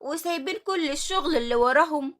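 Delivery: loud, angry telling-off of idle workers; scolding yell. Acted. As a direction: raise your voice and scold them. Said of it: "اتكلمت نعمات بزعيق وقالت".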